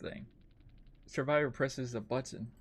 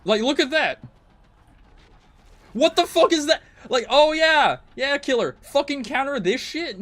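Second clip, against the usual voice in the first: yelling at the screen in real rage; much higher and louder, exploding at the game; full-throated gamer rage; furious shout; 12 semitones higher, 12 dB louder than usual. Real, not acted. Raging at killer game mechanics.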